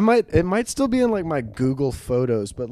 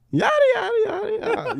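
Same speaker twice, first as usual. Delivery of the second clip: very high, squeaky yada yada; silly high yada; faster than usual. Acted. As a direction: toss it off in a cartoon squeak. Silly filler voice.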